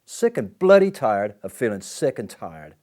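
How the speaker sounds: slow, loud and high, with bloody hammered hard; fed up, letting it all boil over; exasperated angry build. Acted. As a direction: spit out every word of it. Builds the repeat into a shout.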